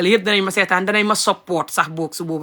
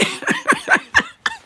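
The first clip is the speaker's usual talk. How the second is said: high-pitched laugh. Real, not acted. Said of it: "I'm laughing".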